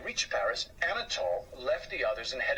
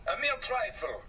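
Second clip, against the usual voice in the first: dismissive mouse voice, pitched up; lightly voiced scoffing Gaston; 9 semitones higher than usual. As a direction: brush it off with a scoff. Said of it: "scoffed Gaston".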